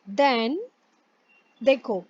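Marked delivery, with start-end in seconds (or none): none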